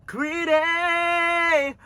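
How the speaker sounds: very high, long-held playful screech; playful squeal. Pretend. Owning up to raiding mom's closet, playful high squeal. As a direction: stretch it out in a piercing high note.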